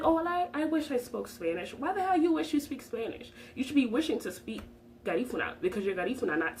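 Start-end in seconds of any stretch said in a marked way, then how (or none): 0.0-1.7 higher, mock-wistful voice imitating them; mocking whiny quote. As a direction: parody their sigh of wishing. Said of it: "who are like".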